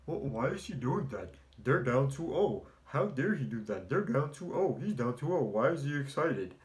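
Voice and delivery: hammed-up imitation of outraged fans; mocking Laker fans. Mocking Laker fans' tweets to his viewers in a whiny voice. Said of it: "what do I see Laker fans posting".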